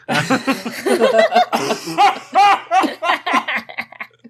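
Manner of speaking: high-pitched, loud laughing fit; real, loud high cackling laugh; long high laugh. Real, not acted. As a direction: burst into prolonged giggling laughter. Laughing over the lucky roll.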